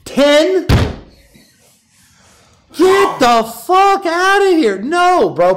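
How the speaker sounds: high, loud, incredulous yelp; mock disbelief. Host hyping his own announcement, mock disbelief. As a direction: play stunned hype at the news.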